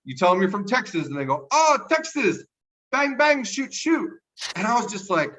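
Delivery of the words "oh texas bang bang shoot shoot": high-pitched mock excitement; high caricature of delighted locals; imitating French locals. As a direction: put on an excited foreigner, pitched far up. Voices French people reacting to Texas.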